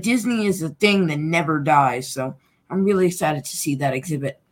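usual speaking voice

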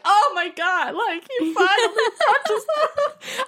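high, loud gushing squeal with a laugh; excited squeal. Retold or imitated. Fan squealing to a friend about the show. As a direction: squeal with delight at the hug. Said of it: "you're just like".

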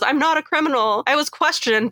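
defensive Roman. Retold or imitated. Host quotes Roman defending himself, a bit high. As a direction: protest your innocence. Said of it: "He even says".

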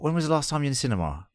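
high, fast, incredulous question; astonished at nan's answer; surprised. Retold or imitated. Shocked at nan's cinema claim.

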